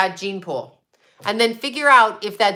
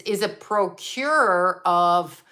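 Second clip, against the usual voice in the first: slow, deliberate, each word weighed; slow knowing delivery; careful coded talk; slower than usual. Real, not acted. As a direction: spell it out slowly so the hint lands. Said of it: "I have to talk like this".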